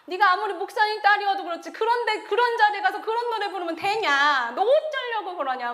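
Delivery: high, loud, exasperated telling-off; scolding teacher. Retold or imitated. Teacher to pastor's daughter after the performance, scolding her. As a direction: imitate a teacher berating a pupil, stretching the questions. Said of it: "선생님한테 또 이 차로 혼났어요".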